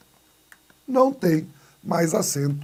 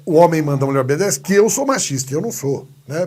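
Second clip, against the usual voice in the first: ordinary talk, nothing marked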